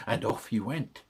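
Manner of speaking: ordinary delivery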